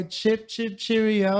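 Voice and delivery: hammy posh British; mock British accent. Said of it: "Most people always go".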